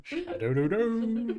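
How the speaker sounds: high, loud, stretched SHADOW DO DO; silly sing-song. Pretend. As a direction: hoot SHADOW DO DO slowly, way up high. Silly repeat of the word.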